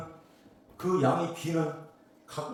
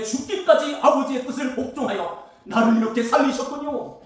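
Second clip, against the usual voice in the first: much higher, louder pleading preacher's prayer; impassioned prayer; 8 semitones higher, 9 dB louder than usual. Acted. Sinner praying to God in the preacher's voice, impassioned pleading. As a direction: cry it out as a repentant sinner.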